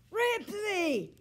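high loud call of the dog's name; shouting. A narrator's reading. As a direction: shout his name into the phone. Boy calling through the phone.

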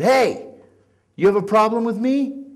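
loud, high challenge across the cafeteria; shouting. Retold or imitated. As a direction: shout it out, daring him to answer. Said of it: "I shouted at him".

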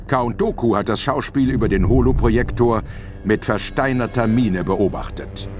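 normal talking voice

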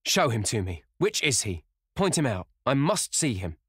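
loud, high, insistent demands; urgent exclamation. A narrator's reading. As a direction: demand urgently to be shown the man. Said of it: "he exclaimed".

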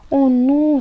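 high, loud cry; alarmed shout. A narrator's reading. Toni sees the magic blow away, alarmed shout. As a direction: call out in dismay. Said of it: "strigă Toni".

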